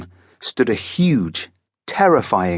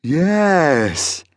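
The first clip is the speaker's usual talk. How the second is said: higher, drawn-out proud monster yes; 6 semitones higher than usual. A narrator's reading. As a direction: swell with pride as the monster. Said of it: "said the monster proudly".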